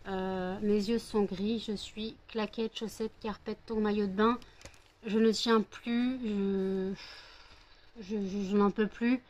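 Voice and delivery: barely able to keep going; exhausted. Real, not acted. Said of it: "j'ai qu'une envie, c'est dormir, ça se voit à ma tête".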